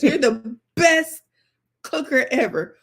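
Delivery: "best" stretched slow and loud; emphasis on best. Retold or imitated. Mom mimics son's praise, heavy stress on "best". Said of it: "put the emphasis on best".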